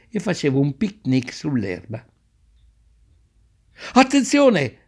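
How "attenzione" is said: grido d'avviso improvviso, molto alto; sudden warning shout. Acted. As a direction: avverti tutti di colpo, acuto e forte. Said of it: "gridò ad un tratto l'avvocato". (l'avvocato avverte che il filobus riparte, grido acuto)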